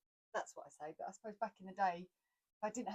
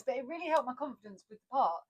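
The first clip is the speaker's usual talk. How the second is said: normal talking voice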